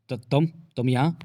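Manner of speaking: slow, stammering surprise; startled stammer. A narrator's reading. Raghunath startled to find Vijay here, stammering slowly. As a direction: trip over the words in shock. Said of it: "Raghunath चौंका".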